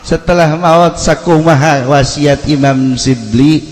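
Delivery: ordinary talk, nothing marked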